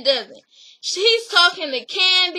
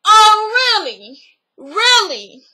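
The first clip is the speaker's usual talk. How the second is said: sarcastic disbelief; 4 semitones higher, 5 dB louder than usual. Retold or imitated. Disbelieving reaction to no confession.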